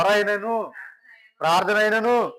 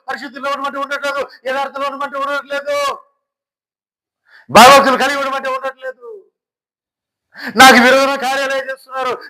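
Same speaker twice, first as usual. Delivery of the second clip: raised, insistent lament over their rebellion; indignant God; 8 semitones higher, 8 dB louder, faster than usual. Acted. God lists the people's sins to his servant, indignant. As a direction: pile up each charge, exasperated.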